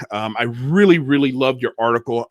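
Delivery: ordinary talk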